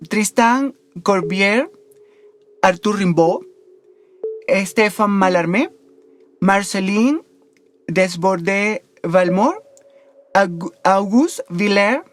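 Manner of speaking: slow, careful attempt at French names; clumsy French pronunciation. Real, not acted. Podcaster reading out French poets' names, clumsy French accent. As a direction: struggle through French names with a Spanish accent. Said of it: "disculparme esa pronunciación nefasta".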